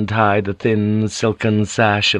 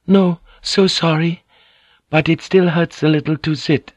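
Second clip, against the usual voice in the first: high, apologetic Japanese woman; thin, high voice; 8 semitones higher than usual. A narrator's reading. Fujiko admits her legs still hurt, thin high voice. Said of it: "in her thin, high voice".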